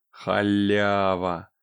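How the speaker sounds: slow, drawn-out greedy glee; gleeful exclamation. Acted. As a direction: savour the freebie slowly. Exclaims at free blade.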